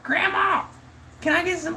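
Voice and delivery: put-on squeaky falsetto kid calling Grandma; mock-yell in a very high child's voice, without real volume; thirteen-year-old yelling. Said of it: "thirteen-year-olds, like, yelling at their grandma in the background".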